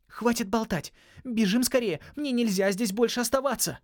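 high, loud frantic hurry; panicked urging. Acted. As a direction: urge them on in alarm. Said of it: "В смятении торопила нас Холли".

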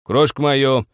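loud, high-pitched command; barked order. A narrator's reading. Old man orders his wife, loud command. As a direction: demand it at full voice. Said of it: "приказал старик".

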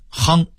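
"慌"念成"夯"，音调上扬; 北京腔读"夯"; Beijing pronunciation. Shown as a demonstration. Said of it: "北京人念夯". (北京话变音念"夯")

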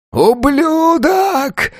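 very high, drawn-out scream of rage; roared. A narrator's reading. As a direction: howl the curse, stretching it. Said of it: "заревел он". (протяжный яростный рёв)

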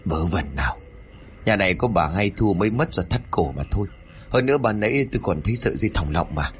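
usual speaking voice

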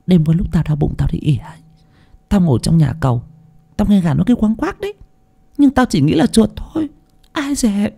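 put-on high, quick servant girl chatter; lightly voiced maid. Maid Tư tells the other maid in high quick voice. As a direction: tell it in a hurried high gossip.

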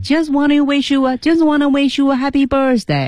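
"Just wanna wish you a happy birthday" on one high, even pitch; high monotone through "happy birthday"; level tune. Shown as a demonstration. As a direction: keep every word up to "birthday" level. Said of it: "一样的一个平调调哈".

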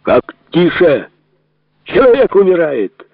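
loud, high-pitched desperate yell; indignant yell. Acted. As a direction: yell back at the guard, voice shooting up. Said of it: "заорал Крымов". (возмущённо орёт, высоко и громко)

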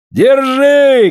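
loud, high shout. Acted. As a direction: yell the order over the storm. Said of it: "кричит Афанасий".

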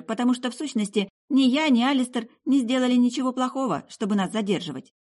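put-on high, emphatic girl's voice; insistent young woman, pitched high; assertive girl. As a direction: argue firmly to end all suspicion. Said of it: "девушка наконец решила положить конец всем подозрениям".

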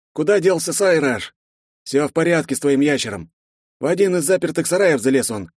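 lightly voiced chiding companion; a hint of exasperated friend; gruff friend. Vogner chides hero.

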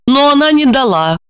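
plain reading voice

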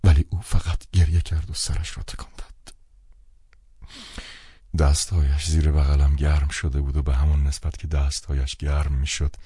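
ordinary delivery, nothing marked

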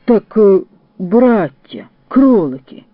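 deeply thoughtful. A narrator's reading. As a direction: pronounce it like a great thinker, pausing between words. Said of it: "глибокодумно мовив кудрявий".

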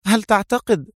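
high-pitched, frowning query; puzzled question. A narrator's reading. Mona begins to question Adham's reasoning, puzzled. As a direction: start asking, pitched high. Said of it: "قطبت منى حاجبيها وسألته".

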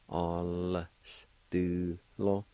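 "All s do lo" drawn out slowly, syllable by syllable; slow demo. Shown as a demonstration. Teacher slowly modelling "All s do lo" for learners. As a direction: stretch each part of "All s do lo". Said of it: "like this".

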